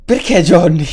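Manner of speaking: loud, incredulous question; amazed protest. Real, not acted. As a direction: cry out why at a generous friend. Protests the gift to Johnny.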